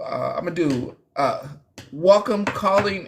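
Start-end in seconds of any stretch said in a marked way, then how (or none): none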